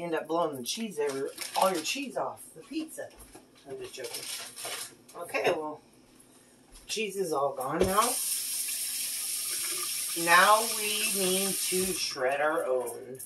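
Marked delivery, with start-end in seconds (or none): none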